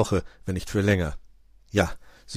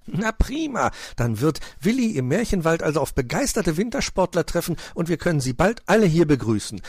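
high, delighted elf; happy, eager welcome; beaming; 9 semitones higher, 4 dB louder than usual. A narrator's reading. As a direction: announce it joyfully with a raised voice. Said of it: "strahlte Puh".

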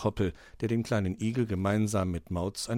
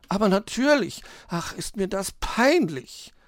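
dismayed; 8 semitones higher than usual. A narrator's reading. Magpie realises she wrecked snowman, dismayed and high. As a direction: agree with mortified embarrassment. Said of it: "war die Elster bestürzt".

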